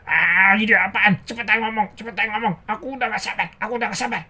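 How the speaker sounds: loud outburst, then high nagging; hammed-up exasperation; acted impatience. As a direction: demand it like a fed-up cartoon character. Angry character demands the idea.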